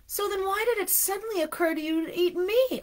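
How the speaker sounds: high-pitched insistent question; demanding. A narrator's reading. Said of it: "Arnie demanded".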